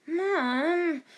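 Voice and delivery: high, embarrassed whine; whining boy. A narrator's reading. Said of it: "Joe whined with slight embarrassment".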